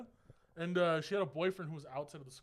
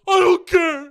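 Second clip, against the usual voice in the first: very high, loud wailing retort; mock upset shout; 13 semitones higher, 16 dB louder, slower than usual. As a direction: yell it like a distraught boyfriend, hammed-up. Boyfriend's upset retort acted out.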